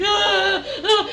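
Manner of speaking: high, loud cartoon scream; hammed-up shriek of terror; mock screaming. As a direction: yell like a panicked man running downstairs. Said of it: "he's, like, screaming".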